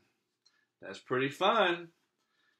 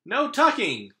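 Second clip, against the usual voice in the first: higher, louder crew shout; crew crying out; 7 semitones higher, 5 dB louder than usual. Acted. Pirate crew echoing their captain, shouting. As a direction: chant it back as the crew. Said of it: "the crew cried".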